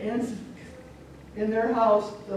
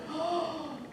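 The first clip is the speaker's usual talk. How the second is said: soft, high intake of breath; dramatic gasp; 9 semitones higher, 5 dB quieter than usual. Acted. Wife steps in front of father, dramatic gasp. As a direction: gasp in mock shock.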